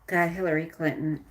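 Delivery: ordinary talk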